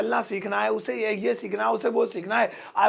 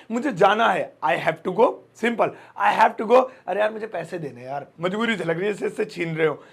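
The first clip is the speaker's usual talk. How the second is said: normal talking voice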